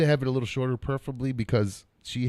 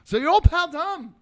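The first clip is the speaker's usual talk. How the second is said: game show announcer; 11 semitones higher than usual. Acted. Mock announcer about himself.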